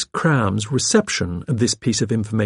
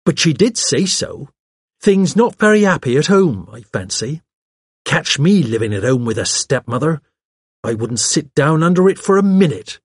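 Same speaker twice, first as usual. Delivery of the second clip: higher, lively, opinionated secretary; pert secretary, gossiping; 5 semitones higher, 4 dB louder than usual. Acted. Secretary gossiping about home life, pert and lively. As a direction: play a chatty, self-assured young secretary. Miss Cram's dialogue in narrated story.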